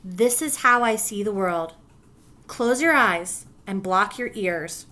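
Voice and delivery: ordinary delivery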